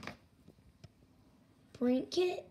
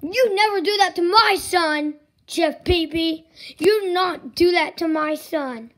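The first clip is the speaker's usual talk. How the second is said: loud, stern scolding; father scolding the chef; angry scolding; 6 dB louder than usual. Acted. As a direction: tell him off hard.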